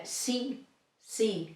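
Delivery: sí with a short pure i, softly; Spanish sí. Shown as a demonstration. Teacher modelling the word for yes. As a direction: say sí clearly, no glide. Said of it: "A word in Spanish with I".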